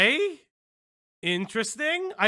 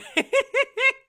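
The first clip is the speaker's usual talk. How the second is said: a high, loud squeal of laughter; shrieking laugh; 6 dB louder than usual. Real, not acted. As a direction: burst into a shrill giggle. Laughing at chat remark.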